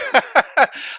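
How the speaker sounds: high, loud burst of laughter; amused laugh. Real, not acted. Caller amused by the assistant's performance, hearty laugh.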